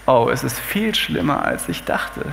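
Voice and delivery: low, soft, voice dropping away to almost nothing; hushed dismay. Acted. Friends seeing the sick Hiob, in hushed dismay. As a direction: let the dismay sink into a near-whisper by the end. Friends see Hiob's sores, shocked.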